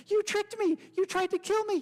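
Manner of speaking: high, loud squeal of alarm; panicked mouse. Acted. The soaked mouse accuses the frog, a high loud panicked squeal. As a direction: cry out as if nearly drowned.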